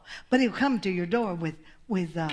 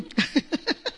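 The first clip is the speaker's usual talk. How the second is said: high, airy burst of laughter; amused laughter; 6 semitones higher than usual. Real, not acted. Preacher tickled by listeners' looks, laughing. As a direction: giggle helplessly for real.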